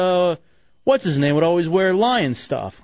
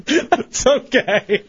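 giggling through a tickle; being tickled; 7 semitones higher, slower than usual. Real, not acted. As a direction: laugh while squirming. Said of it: "that tickles".

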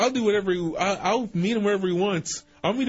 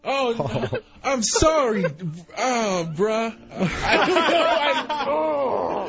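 laughing; 5 semitones higher, much slower than usual. Real, not acted. Caught off guard by prank call.

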